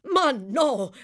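high, loud, forceful denial; vehement cry. Acted. Doctor contradicting the distraught mother, vehement cry. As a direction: cry out with fervour. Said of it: "gridò il dottore con foga".

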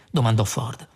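usual speaking voice

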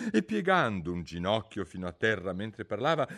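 plain reading voice

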